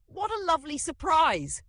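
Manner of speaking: very high, loud granny squeal; squeaky tiny grandma. A narrator's reading. Grandma's voice coming out of dad, squeaking. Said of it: "Grandma's tiny voice squeaked from him".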